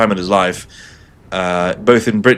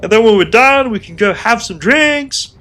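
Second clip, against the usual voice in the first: imitating Chris Roberts; 13 semitones higher, 4 dB louder than usual. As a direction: cheerfully promise drinks after the show. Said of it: "Chris Roberts says".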